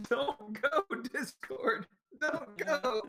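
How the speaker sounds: high, pleading cry to a dying friend; mock pleading. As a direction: beg it not to leave. Begging the dying Discord.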